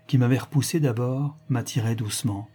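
ordinary delivery

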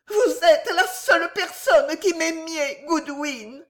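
high, tearful hysterical wife; touching appeal. A narrator's reading. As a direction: wail it pitifully to the loyal maid. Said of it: "À cette apostrophe touchante". (plainte larmoyante et suraiguë)

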